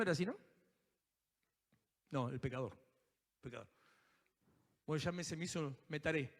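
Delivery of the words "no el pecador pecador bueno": usual speaking voice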